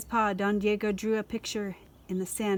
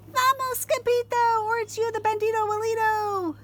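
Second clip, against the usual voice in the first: high urgent cry; frightened chihuahuas calling; 12 semitones higher, 4 dB louder than usual. Acted. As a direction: call out in panic as they scatter. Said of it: "they cried".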